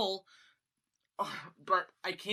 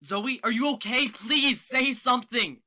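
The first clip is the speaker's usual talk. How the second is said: worried plea; 4 semitones higher, 7 dB louder than usual. Acted. Begging Zoe to respond.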